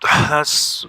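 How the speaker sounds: heavy breath right into the microphone; sigh into the mic. Real, not acted. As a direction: let out a loud sigh into the mic. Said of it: "sorry for breathing into the mic".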